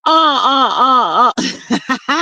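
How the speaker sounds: high squealing laugh bursting out. Real, not acted. Laughing at her own plan for the viewer. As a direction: crack up laughing at the idea.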